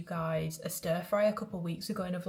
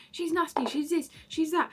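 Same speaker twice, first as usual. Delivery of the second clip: higher, mocking voice of online critics; mocking online haters; 9 semitones higher, 4 dB louder than usual. Mocking the online critics in a higher sneering voice. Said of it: "people are like".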